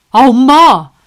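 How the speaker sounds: loud, high exasperated protest; protesting cry. Acted. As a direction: cry out to stop her. Son protests.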